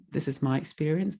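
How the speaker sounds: ordinary talk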